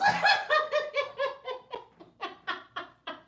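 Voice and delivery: high, long laughing fit; loud laugh. Real, not acted. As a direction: lose it completely. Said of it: "Y'all got me cracking up here".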